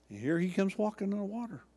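ordinary delivery

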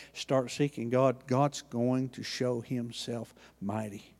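normal talking voice

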